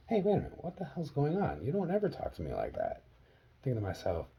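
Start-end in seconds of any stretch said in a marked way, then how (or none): 0.1-3.0 retold indignant protest, starting high and loud; startled protest. Son retelling his reply to mom's insult, startled protest. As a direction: object in disbelief, high at first then easing down.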